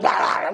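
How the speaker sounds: low, rough mock barking; imitated dog barking. Storyteller imitates his friend's husky going nuts, mock barking. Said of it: "the dog would be like".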